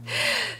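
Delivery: light laugh at herself; quick embarrassed giggle; short self-amused laugh. Real, not acted. Laughs at own accent.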